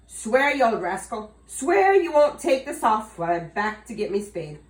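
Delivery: loud, firm demand; demanding Tom. Acted. Tom loudly making the leprechaun swear. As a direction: make him swear it.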